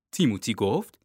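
ordinary delivery, nothing marked

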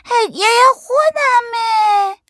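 put-on piercingly high, drawn-out kid's yell; screamed in a stretched-out small child voice; shrieking child. A narrator's reading. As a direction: squeal it loudly, claiming what is yours. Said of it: "آذین داد زد".

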